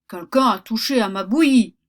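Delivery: loud, booming papa bear; a big bear grumbling loudly at his porridge; big bear's gruff voice. Acted. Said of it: "dit le grand gros ours de sa grosse voix".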